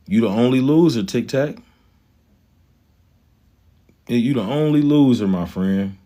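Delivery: usual speaking voice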